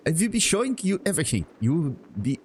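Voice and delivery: high, hammed-up German salesman; mangled German accent. Merchant promising to show his wares, mangled German accent. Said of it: "I'm mangling this".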